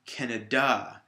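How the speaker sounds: "Canada" said as can-uh-DAH; stress on last syllable. Shown as a demonstration. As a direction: land on the final syllable of "Canada". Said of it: "if I put it at the end".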